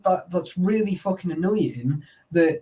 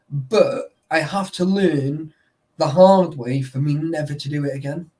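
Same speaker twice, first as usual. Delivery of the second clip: usual speaking voice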